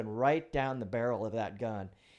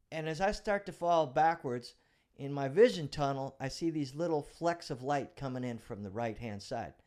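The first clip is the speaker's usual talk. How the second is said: ordinary talk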